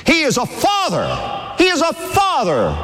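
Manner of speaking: preaching shout. Real, not acted. Preacher shouting to his congregation that God is a father, full force. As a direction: cry it out to the whole church.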